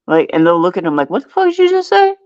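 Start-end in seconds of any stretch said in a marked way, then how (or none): 1.1-2.1 fast, very high-pitched incredulous challenge; mock an affronted customer demanding an explanation; offended disbelief. Retold offended customer challenging prankster.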